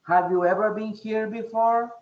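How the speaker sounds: "Have you ever been here before" pitched high, climbing to the end; high rising question tune through to "before"; question intonation. Shown as a demonstration. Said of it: "use the intonation".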